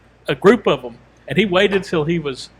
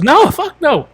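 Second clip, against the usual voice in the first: high, loud mock outrage; emphatic refusal; 12 semitones higher, 8 dB louder, much slower than usual. Man asked to apologize to attackers, outraged retold refusal. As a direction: imitate an outraged refusal, pitch leaping up.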